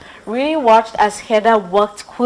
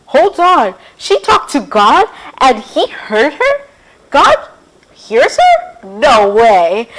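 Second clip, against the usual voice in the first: loud, high disbelieving outburst; can't believe it, squeal it out; astonished; 3 semitones higher, 6 dB louder, slower than usual. Acted. Rudy shocked that God heard Hannah, astonished.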